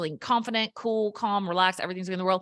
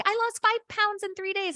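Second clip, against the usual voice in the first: much higher, giddy voice of a proud new dieter; excited keto newbie; 14 semitones higher than usual. Retold or imitated. Mocking a new keto dieter's thrilled boast. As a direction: gush it like a thrilled beginner showing off. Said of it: "they're like".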